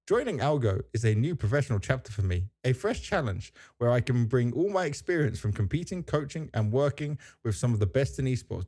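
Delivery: ordinary delivery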